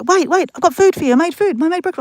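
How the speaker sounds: high, loud, urgent mum voice; imitated fussing mum. Mum stopping her daughter with food, imitated urgent calling. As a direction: call her back as an insistent mum.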